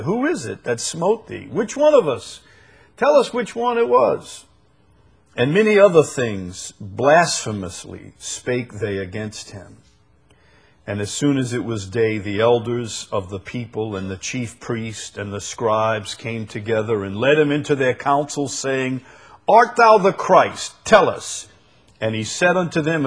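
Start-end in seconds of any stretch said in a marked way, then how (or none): 0.0-4.5 high, taunting questions; mocking. Guards taunt the blindfolded Jesus, mocking. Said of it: "mocked him and smote him". 19.5-21.5 loud, high interrogation; demanding. Acted. The council questions Jesus, demanding.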